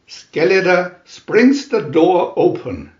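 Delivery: plain reading voice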